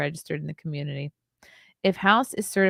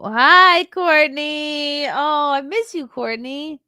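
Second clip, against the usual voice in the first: much higher, louder, gushing; high excited sing-song hello; gushing greeting; 9 semitones higher, 6 dB louder, much slower than usual. Real, not acted. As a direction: greet a dear friend with delight. Greeting a favourite chatter.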